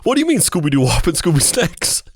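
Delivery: baffled, offended tough-guy put-on; angry Paul Sorvino. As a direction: demand an explanation in hammed-up outrage. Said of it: "extremely angry about everything all the time".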